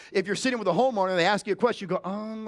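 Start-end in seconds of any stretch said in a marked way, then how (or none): 2.1-2.5 soft, unsure "Um"; hesitant um. Shown as a demonstration. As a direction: stall before answering. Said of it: "like this".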